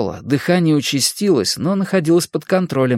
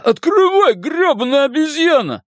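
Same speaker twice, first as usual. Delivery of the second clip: yelling. Acted. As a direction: scream insults at him. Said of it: "рявкнул Рыгало".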